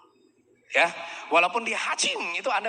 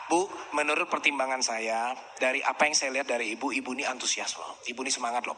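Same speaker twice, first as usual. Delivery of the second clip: low, much softer praise; soft flattering; 7 semitones lower, 5 dB quieter than usual. Shown as a demonstration. Salesman flattering a hesitant client, lower and softer. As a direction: coax her gently, lower and softer. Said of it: "Anda yang harus arahkan".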